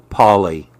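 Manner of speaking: ordinary delivery, nothing marked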